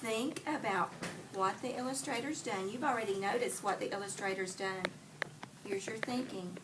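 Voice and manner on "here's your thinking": hushed aside to the pupil; whisper. Real, not acted. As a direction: whisper it like a secret hint. Teacher whispers hint to pupil.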